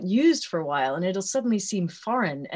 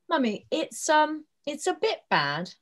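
imitating six-year-old; 4 semitones higher than usual. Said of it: "he'll go".

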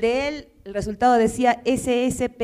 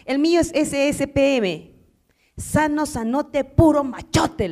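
slow, proud macho bragging; macho boasting; 6 semitones higher, 3 dB louder, slower than usual. Acted. Husband bragging to the nurse about his results, slow and proud. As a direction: stretch out each boast with swagger.

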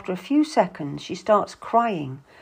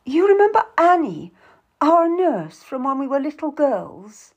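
high, louder insistent question; demanding; 7 semitones higher, 5 dB louder than usual. A narrator's reading. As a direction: press your sister urgently. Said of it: "Lucy demands".

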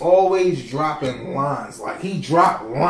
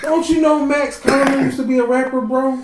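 high, excited announcement; excited disbelief; 9 semitones higher than usual. Real, not acted. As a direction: blurt it out like big news. Excited reveal to friends.

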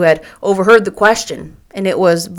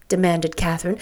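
ordinary delivery

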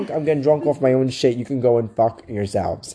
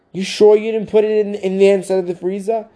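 higher, louder challenge; mock accusing yell; 8 semitones higher, 5 dB louder than usual. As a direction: call him out, half-shouting. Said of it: "she goes".

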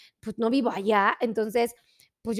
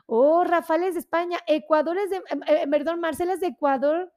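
usual speaking voice

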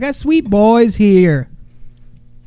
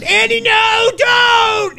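retold shout; much slower than usual. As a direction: shout at Andy to stop before he rips the headphones. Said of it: "I said".

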